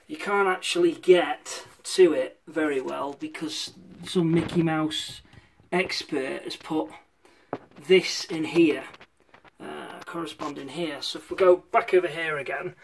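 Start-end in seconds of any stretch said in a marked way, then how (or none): 0.1-11.0 muttered under breath. Real, not acted. Grumbling at awkward fitted drawer.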